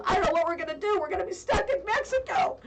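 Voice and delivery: high, worried husband voice, half laughing; mock panicked husband. Husband stuck in Mexico, mock panicked. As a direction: play the husband's mock despair. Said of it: "My husband goes".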